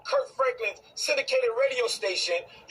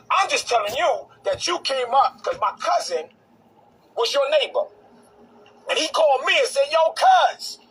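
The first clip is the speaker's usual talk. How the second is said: louder and higher as the anger builds; rising heated rant; 4 semitones higher, 6 dB louder than usual. Real, not acted. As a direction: build up into a loud, heated call-out.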